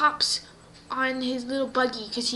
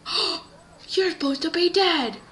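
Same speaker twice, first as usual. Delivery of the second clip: shocked gasp; 5 semitones higher, 5 dB louder than usual. Acted. Girl shocked at dead villain's return.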